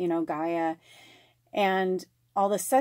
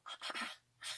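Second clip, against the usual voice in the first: cat chatter; 19 dB quieter, much faster than usual. Retold or imitated. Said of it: "when cats see a bird, they'll go".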